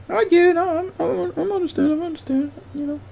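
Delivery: very high squeaky falsetto; mock squeaky voice. Streamer teasing a chatter about outfits in high falsetto.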